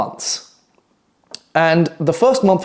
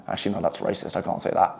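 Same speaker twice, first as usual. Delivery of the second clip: hushed aside; 9 semitones lower, 11 dB quieter than usual. Real, not acted. To the viewers, quietly taking back a joke. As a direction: drop to a near-whisper, taking it back.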